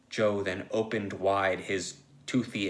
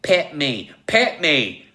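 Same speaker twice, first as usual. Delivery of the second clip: high, loud repeated demand; insist, louder each time; impatient; 6 semitones higher, 10 dB louder, slower than usual. Acted. Joe demands petting from Logue, impatient shouting. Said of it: "Joe asked impatiently".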